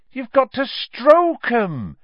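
slow, high, loud matter-of-fact Hagrid; as if it's the most obvious thing; obvious explanation. A narrator's reading.

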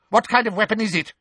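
fast, hard demand; harsh. A narrator's reading. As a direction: snap the question quickly. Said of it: "said Umbridge harshly".

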